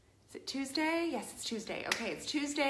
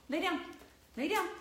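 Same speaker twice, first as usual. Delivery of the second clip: talking to a dog; 7 semitones higher than usual. Real, not acted. Repeated commands to the dog.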